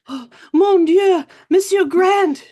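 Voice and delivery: loud, high breathless French cry; alarmed Frenchwoman. Acted. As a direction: burst in with a dramatic French gasp. Frightened Frenchwoman bursts into the office.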